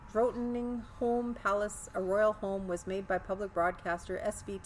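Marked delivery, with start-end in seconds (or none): none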